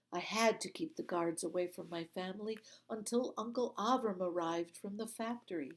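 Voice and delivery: ordinary delivery, nothing marked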